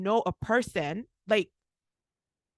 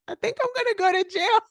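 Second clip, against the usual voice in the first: fast, much higher mock panic; panicked thought; 9 semitones higher, faster than usual. Keys jingle in her pocket, mock panic. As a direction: blurt it as sudden dread. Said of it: "it hits me like".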